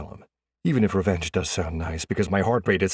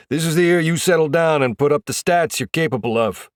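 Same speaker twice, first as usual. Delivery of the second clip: loud, raised coach's exhortation; head coach; 8 semitones higher, 6 dB louder than usual. A narrator's reading. Head coach pushes player to deliver stats, loud exhorting coach voice. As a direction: drive it home like a coach.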